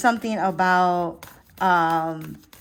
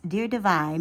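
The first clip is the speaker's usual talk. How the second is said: normal talking voice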